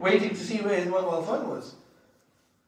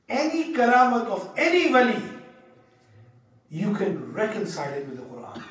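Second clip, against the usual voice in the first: usual speaking voice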